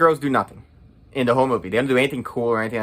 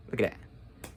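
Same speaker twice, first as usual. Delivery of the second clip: hushed aside; 4 semitones lower, 13 dB quieter, slower than usual. Real, not acted. Pointing at his own drawing.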